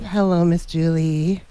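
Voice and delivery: ordinary delivery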